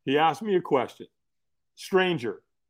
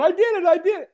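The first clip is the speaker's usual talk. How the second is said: high, elated cheer; triumphant celebrating; 12 semitones higher, 5 dB louder, faster than usual. Retold or imitated. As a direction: crow it in disbelieving triumph. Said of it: "all of a sudden I'm going".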